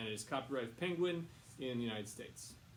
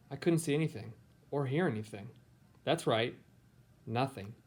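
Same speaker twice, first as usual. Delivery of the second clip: clear, louder storybook reading voice; reading to children; 10 dB louder than usual. A narrator's reading. Teacher reading a picture book to her students, storybook voice. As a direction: read it aloud to a class of kids.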